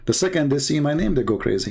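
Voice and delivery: ordinary talk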